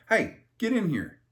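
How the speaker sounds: stern call. Pretend. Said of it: "I'm gonna be like".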